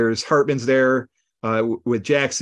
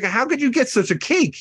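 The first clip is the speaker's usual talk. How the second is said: higher, amazed question about the cake; mock amazement; 10 semitones higher than usual. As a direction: play it half-amazed, pitched up. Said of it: "they're like".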